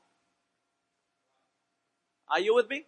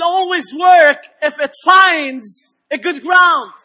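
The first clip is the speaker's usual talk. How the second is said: emphatic preaching, louder on key phrases, with drawn-out words; 6 semitones higher, 8 dB louder, slower than usual. Real, not acted. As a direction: build to a raised, declaiming sermon voice. Preaches at full force.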